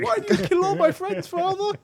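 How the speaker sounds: put-on squeaky high pleading kid; high whiny child voice. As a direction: whine it like a wronged child. Mock Kratos family scene.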